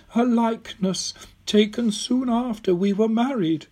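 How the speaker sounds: put-on high old lady's voice; old woman, fond. Old woman showing Tom her husband's photograph, fond and high. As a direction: explain it in a high, elderly voice.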